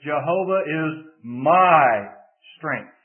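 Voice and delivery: slow build to a loud, long "my", then pause before "strength"; stress on MY. Shown as a demonstration. Preacher shifting emphasis to the third word, stress on MY. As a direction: lean hard on "my" and stretch it out. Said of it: "the emphasis to the third word".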